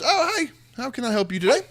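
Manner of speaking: high, lilting innkeeper voice; high-pitched barkeep hello; friendly barkeep greeting. Acted. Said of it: "Erwin's standing there behind ... and says".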